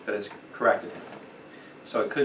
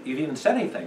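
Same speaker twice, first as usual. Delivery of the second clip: ordinary delivery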